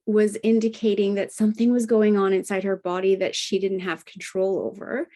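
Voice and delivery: ordinary delivery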